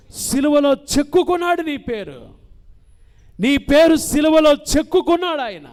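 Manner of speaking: loud, high, fervent sermon delivery; rousing Telugu preacher at full force; fervent preaching. Acted.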